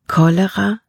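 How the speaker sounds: plain reading voice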